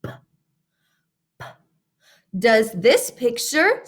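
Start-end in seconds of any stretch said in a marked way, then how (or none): 0.0-1.4 crisp puffed P sound, said twice; isolated P sound. Shown as a demonstration. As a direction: pop the P cleanly.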